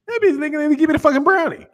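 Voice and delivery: high, fast mock disbelief; high-pitched rapid outburst; mock incredulous reaction. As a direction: rattle it off high and level.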